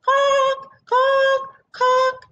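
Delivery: high clucking cock cock; bird call. Acted. Bushfowl calls to wake the sun, high clucks. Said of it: "her familiar call".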